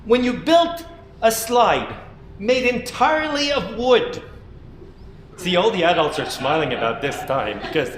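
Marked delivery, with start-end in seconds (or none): 0.0-4.2 slow. A narrator's reading. Slow buildup.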